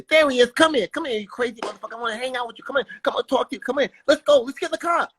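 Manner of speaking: high, excitable boxer impression; Mike Tyson. Boxer inviting young comic to hang out, high eager impression. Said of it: "Mike runs up to me after".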